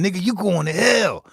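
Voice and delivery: mock preacher warning of hell; preachy church man. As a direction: play the outraged churchgoer, loud and high. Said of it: "one of the Christians told me".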